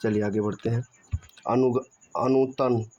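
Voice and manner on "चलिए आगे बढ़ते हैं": ordinary talk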